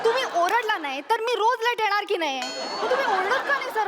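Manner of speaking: ordinary delivery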